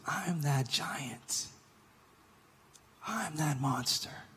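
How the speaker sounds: soft, slow confessional whisper; preacher whispering that he is the giant. Real, not acted. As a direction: drop to a hushed confession. Confessional hush in sermon.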